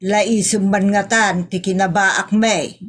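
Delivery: ordinary delivery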